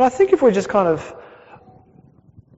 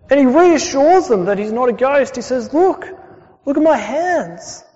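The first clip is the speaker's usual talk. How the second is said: lightly voiced Jesus, higher and calming his friends; a hint of Jesus reassuring frightened disciples, pitched higher; 6 semitones higher, 3 dB louder than usual.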